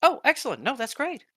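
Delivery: high, bright, loud delight. Acted. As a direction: gush with relief. Elise pleased by news.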